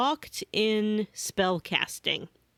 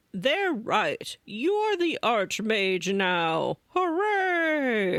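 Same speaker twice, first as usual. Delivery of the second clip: mock-cheerful old man; much slower than usual. Old man declares Archmage.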